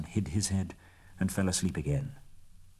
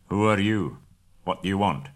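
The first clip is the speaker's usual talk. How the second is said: a hint of a gruff huge man; gruffly; 5 dB louder than usual. A narrator's reading. Huge man at the gate questioning strangers, mildly gruff. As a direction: challenge the strangers with a touch of gruffness. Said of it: "he asked gruffly".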